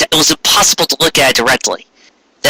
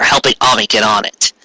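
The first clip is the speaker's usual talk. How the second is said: ordinary delivery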